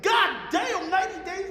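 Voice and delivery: loud, high disbelieving shout; a retold outraged yell; shocked outburst. Shocked outburst at bank claim.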